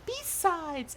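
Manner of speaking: high, soft female voice; high, light put-on voice; Spirit. Shown as a demonstration. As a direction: lift it high and keep it soft. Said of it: "Spirit has to be like a".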